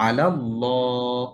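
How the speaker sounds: drawn-out recitation with a heavy, dark "ল্লা" in "নাসরুল্লাহ"; heavy lam. Shown as a demonstration. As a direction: slowly hold a full, rounded "llaw" in each "ল্লাহ". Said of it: "সেই লামটা মোটা করে উচ্চারণ". ("ল্লাহ"-এর লাম মোটা করে, টেনে উচ্চারণ)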